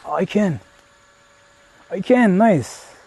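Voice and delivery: ordinary talk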